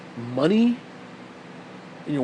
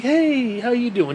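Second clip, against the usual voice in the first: higher-pitched mock-friendly greeting; exaggerated chummy hello; mock friendliness; 7 semitones higher, slower than usual. Acting out friendly hello between opposed believers, mock friendliness.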